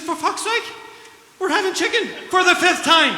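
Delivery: high, loud exasperated shout; fed-up wife shouting. Retold or imitated. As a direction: yell it back like a wife who's had enough. Wife snaps back in joke.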